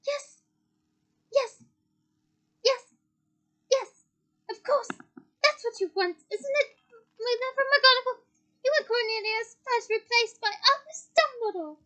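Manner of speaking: high, loud, frenzied tirade; shrieking accusation. A narrator's reading. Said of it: "shrieked Professor Umbridge".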